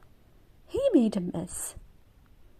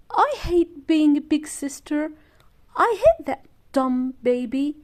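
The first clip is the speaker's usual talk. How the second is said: louder, higher sulking shout; angry shout; 4 semitones higher, 5 dB louder than usual. A narrator's reading. Angry big sister shouting about the baby. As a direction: yell it from the bedroom. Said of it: "Elizabeth shouted".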